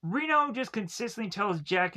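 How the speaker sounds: ordinary talk, nothing marked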